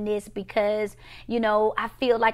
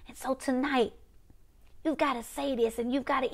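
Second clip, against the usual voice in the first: ordinary talk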